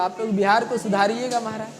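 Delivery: ordinary delivery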